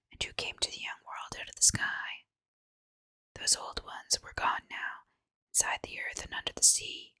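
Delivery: usual speaking voice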